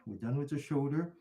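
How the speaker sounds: ordinary talk, nothing marked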